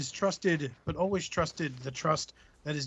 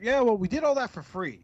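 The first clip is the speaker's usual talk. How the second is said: mock brush-off, a bit higher; dismissive network reply; 4 semitones higher than usual. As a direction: shrug it off like a smug network rep.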